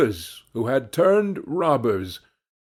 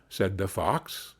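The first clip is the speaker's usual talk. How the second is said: plain reading voice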